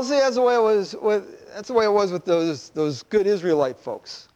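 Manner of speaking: ordinary talk, nothing marked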